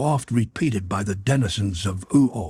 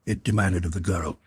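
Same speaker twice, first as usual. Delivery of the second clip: ordinary delivery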